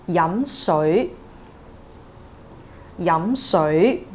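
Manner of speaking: yam shui, both syllables rising; Cantonese rising tones. Shown as a demonstration. Teacher models yam shui, both rising. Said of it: "two and two".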